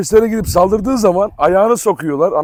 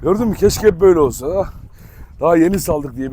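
ordinary talk, nothing marked

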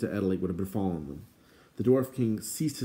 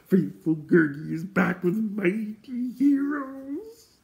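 very high, slow, drawn-out creature voice; excited Gurgi; 3 dB louder, slower than usual. A narrator's reading. Excited creature boasting of his deeds to friends, high gleeful crowing. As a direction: crow it out high with gleeful, stretched words. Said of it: "Gurgi was so excited he began dancing".

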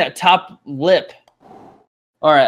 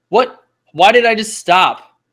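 loud, high, baffled cry; shocked and loud, demanding why; startled outburst; 7 semitones higher, 9 dB louder than usual. Real, not acted. Ball stops suddenly mid game.

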